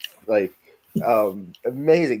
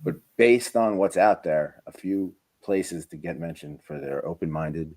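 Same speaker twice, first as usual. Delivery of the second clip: ordinary delivery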